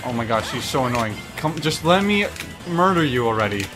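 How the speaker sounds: normal talking voice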